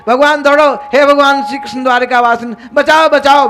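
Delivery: loud, high cry for rescue; desperate call for help. Acted. Uttara runs calling on Krishna, desperate cry for help. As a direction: call out urgently, acting desperate.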